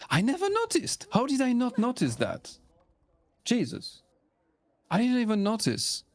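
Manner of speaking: surprised. Real, not acted. Realizes he missed the voice lines.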